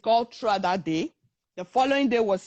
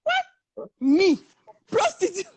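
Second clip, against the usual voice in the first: very high, offended one-word questions; each word a high, stunned jab; indignant disbelief; 8 semitones higher, 3 dB louder, slower than usual. Retold or imitated. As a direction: imitate an affronted friend. Friend's indignant reaction to insult.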